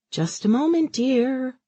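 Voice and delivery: high sing-song coo to her son. Acted. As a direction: coo it sweetly, a little unhinged. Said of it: "she cooed".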